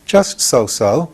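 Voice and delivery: slow, modest shrug of a reply; modestly. A narrator's reading. Boy Tip modestly brushing off his handiwork, slow. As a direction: play it down, drawn out and humble. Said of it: "replied Tip modestly".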